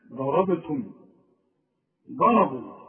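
ضربتم and ضربوا with a heavy, thick ض; ضربوا opened with a deep emphatic ض; emphatic dad. Shown as a demonstration. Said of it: "تطلعها لي ضاد".

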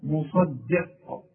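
"مصدق" slow, sad not turned to dad; correct sad sound. Shown as a demonstration. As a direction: stretch out "مصدق" with a clean sad. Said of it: "عايزك تطلعها لي دال".

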